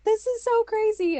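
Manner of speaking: very high, loud mock squeal; squealed astonishment, acted out; amazed outburst. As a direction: imitate an overwhelmed relative's squeal. Quotes sister-in-law reacting while she bawled.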